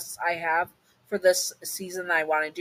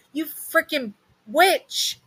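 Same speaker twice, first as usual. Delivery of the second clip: higher, slow furious cry; angry snap; 11 semitones higher, 5 dB louder, much slower than usual. A narrator's reading. You, tied up, snapping at Oogie Boogie in anger. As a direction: spit it at her in anger. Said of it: "you snap at her".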